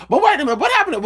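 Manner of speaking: high, sudden surprise; wait, what happened, sassy and high; mock surprise. Pretending to a friend, high mock surprise.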